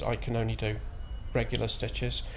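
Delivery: usual speaking voice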